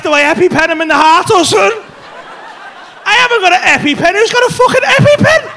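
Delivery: high, loud flustered questioning; retold panic. Comedian re-living a friend's emergency with no idea what to do, panicked.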